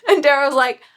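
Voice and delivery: ordinary talk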